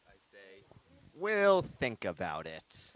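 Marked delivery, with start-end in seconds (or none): 1.1-2.9 slow, louder villain voice; evil voice. Acted. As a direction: draw it out like a scheming villain. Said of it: "in my evil voice".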